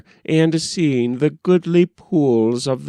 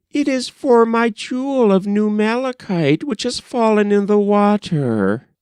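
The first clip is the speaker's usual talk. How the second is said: put-on much higher and quicker girlish voice; a small rower girl answering the king, high and quick; young maiden; 9 semitones higher than usual. A narrator's reading.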